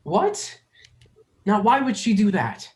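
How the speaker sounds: way up high and loud, incredulous; shocked disbelief. Acted. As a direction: squeak out in utter disbelief. Aeon spat in hand.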